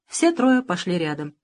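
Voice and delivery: ordinary delivery, nothing marked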